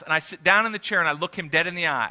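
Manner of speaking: ordinary talk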